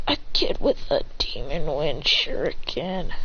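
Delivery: low, slow, near-whispered line; hushed acted disbelief. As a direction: drop low and whisper it slowly. Brother reacts to who did it.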